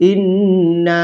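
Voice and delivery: ইন্না with the final "na" stretched for three alif counts; long madd. Shown as a demonstration. Teacher showing learners the three-alif stretch, drawn-out ইন্না. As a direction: hold the long "aa" ending of ইন্না, evenly. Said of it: "তিন আলিফ টানতে হবে".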